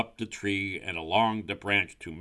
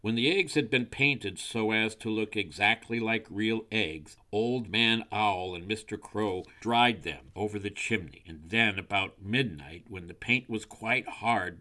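usual speaking voice

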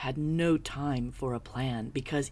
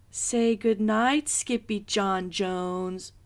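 loud, high, slow call; 6 semitones higher, 7 dB louder, slower than usual. A narrator's reading. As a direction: call him from down the hall. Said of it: "called his mother".

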